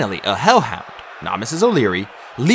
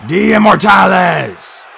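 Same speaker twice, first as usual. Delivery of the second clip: high, slow, very loud cry; yelled exclamation; 8 semitones higher, 9 dB louder, much slower than usual. A narrator's reading. Chiron reacting as the monster appears, drawn-out yell. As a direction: draw out a huge battlefield shout. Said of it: "Chiron yelled".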